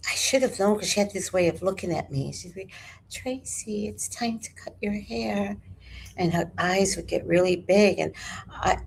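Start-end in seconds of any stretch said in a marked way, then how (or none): 3.1-5.6 soft, higher-pitched imitation of mother; hushed, lilting menace; eerie mother. Mother to her daughter before cutting hair, eerily soft.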